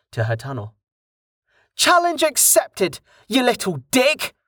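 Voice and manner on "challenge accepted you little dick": much higher defiant shout; raging at the heavens. A narrator's reading. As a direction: hurl it up at the sky. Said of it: "she shouted to the heavens".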